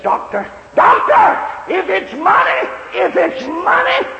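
high, loud, frantic begging for his son; a father crying out to the doctor; desperate pleading shout. Retold or imitated. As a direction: shout it, pleading for the boy's life.

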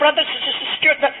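imitated stutter, stuck on the S; mock speech impediment. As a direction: stumble on the first sounds, then push through. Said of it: "he spluttered and stuttered like an old Ford".

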